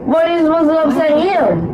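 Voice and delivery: loud shouting. Acted. Loud commercial dialogue.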